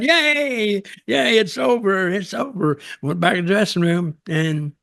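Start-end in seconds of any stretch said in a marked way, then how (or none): 0.0-2.8 high, bouncy mock cheer of relief; happy cheer. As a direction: squeal it like a kid let out of school. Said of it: "I was jumping up, down".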